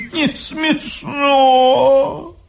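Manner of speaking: very slow, high sing-song howl; mock-tragic crying chant; tearful wail. A narrator's reading. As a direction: stretch it into a long half-sung sob. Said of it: "не то заплакала".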